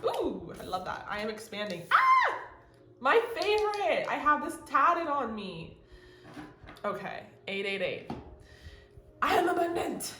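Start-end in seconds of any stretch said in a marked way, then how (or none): 1.9-2.4 short high-pitched loud squeal; excited squeal. Real, not acted. Reader delighted by the card's message, excited squeal. 3.0-5.8 high, loud, thrilled outburst; excited delight. Real, not acted. Reader spotting the message tattooed on her, excited. 9.2-10.1 high, loud, excited declaration; exuberant affirmation. Real, not acted. Reader declaring her favorite affirmation, exuberant.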